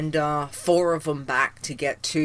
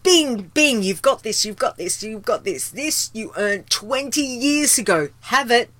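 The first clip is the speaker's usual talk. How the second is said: cheery game voice; 7 semitones higher, 6 dB louder than usual. Retold or imitated. Acting out game handing rewards.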